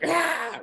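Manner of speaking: high mock scream at the werewolf. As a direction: let out a short scream of fright. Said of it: "And it's like".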